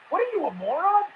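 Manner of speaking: mock-outraged get off my porch; angry homeowner. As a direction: snap back at the fool on your porch. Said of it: "I'd be like".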